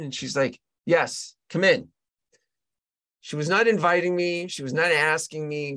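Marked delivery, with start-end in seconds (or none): 0.8-1.9 commanding. Retold or imitated. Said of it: "She was commanding me".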